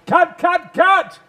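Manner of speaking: loud high call to stop; shouting cut. Retold or imitated. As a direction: yell to halt the scene. Director stopping the take.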